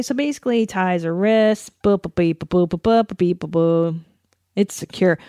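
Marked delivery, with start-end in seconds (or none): 1.8-4.0 light rhythmic boops and beeps; playful boop-beep noises. Pretend. Skimming past the wrist-tying scene, playful sound effects. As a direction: patter nonsense beeps to skim the action.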